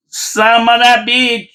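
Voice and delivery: high, slow imitated furious yell; hammed-up shriek of an angry grandmother; mock yelling nona. Italian nona screaming at the mayor, hammed-up furious yell. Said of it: "you know, yelling".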